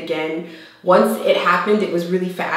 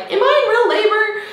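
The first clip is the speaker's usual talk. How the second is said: high, whiny worried question; exaggerated anxious whine; mock fretting; 4 dB louder than usual. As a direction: imitate your own silly doubting, pitched way up. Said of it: "being this silly person".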